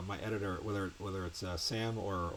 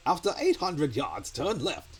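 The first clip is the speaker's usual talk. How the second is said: loud, raised-pitch celebrity sat-nav impression; John Cleese; 8 semitones higher, 8 dB louder, slower than usual. Recalling celebrity sat-nav voices, John Cleese impression. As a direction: announce the directions in a raised, projected voice. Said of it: "like, you know, John Cleese used to".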